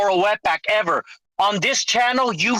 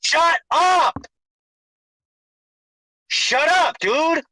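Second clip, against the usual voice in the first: yelling; 4 semitones higher, much slower than usual. Real, not acted. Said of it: "Dude, why are you yelling".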